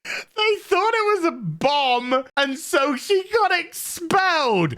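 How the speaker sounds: slow, high and squeaky with suppressed laughter; barely holding laughter. Real, not acted. Reviewer finishing the expulsion story, squeaky and nearly laughing. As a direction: struggle to get it out without collapsing.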